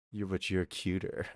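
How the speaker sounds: low, soft and smooth; a smooth line, said low and soft; smooth flirty low voice. Retold or imitated. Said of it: "you look at them going".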